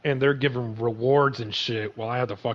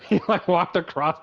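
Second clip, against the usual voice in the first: laughing; 8 semitones higher, 7 dB louder than usual. Real, not acted. Laughing at donkey.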